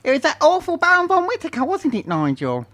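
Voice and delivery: high silly character voice; Herbert character voice. Acted. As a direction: play the high-pitched sidekick.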